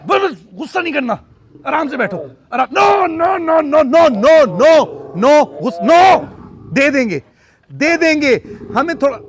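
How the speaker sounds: high, loud panicked no no no; real shouting. Real, not acted. Owner fends off angry mother cat, loud high shouting.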